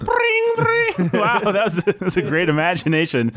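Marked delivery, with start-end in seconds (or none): 0.0-1.1 high ringing mouth-made instrument noise; imitated harp flourish. Host launching an imagine sequence, imitated harp sound. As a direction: trill it very high like a terrible instrument. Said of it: "that's my impression".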